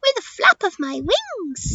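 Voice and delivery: high, swooping little bird voice; chirpy parrot. Acted. Parrot answering how she'll cross, high and chirpy. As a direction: pipe it up like a storybook parrot.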